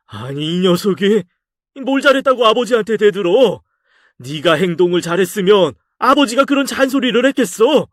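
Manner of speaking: how dare you, pitched high; angry father. Acted.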